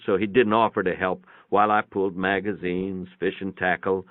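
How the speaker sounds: ordinary delivery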